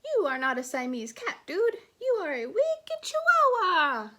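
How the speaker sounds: loud, hammed-up Spanish accent; theatrical Spanish-accented boast, climbing high. A narrator's reading. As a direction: declare it in a mock Spanish accent, pitch soaring. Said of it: "using his very best Spanish accent".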